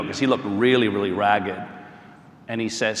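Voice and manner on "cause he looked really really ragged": ordinary talk, nothing marked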